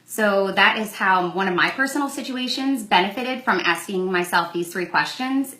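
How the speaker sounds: ordinary talk, nothing marked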